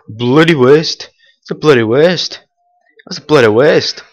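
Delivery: grumbling gamer; disgusted repeat. Real, not acted. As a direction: sulk over the wasted shot.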